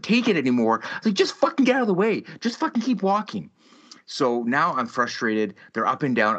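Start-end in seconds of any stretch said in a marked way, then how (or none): 1.0-3.5 exasperated. Retold or imitated. Fed up with kids passing.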